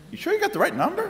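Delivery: high, laughing disbelief; incredulous. Retold or imitated. Speaker asks the caller if she dialled right, incredulous high squeak. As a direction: jump way up in disbelief.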